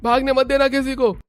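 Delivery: shrill-pitched henchman order; high goon voice. Acted. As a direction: yell it in a high squeaky voice.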